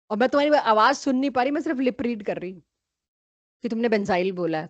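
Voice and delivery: ordinary talk, nothing marked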